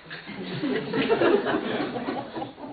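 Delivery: silent wheezy laugh; a breathless chuckle; breathy laugh. Real, not acted. Teller laughs.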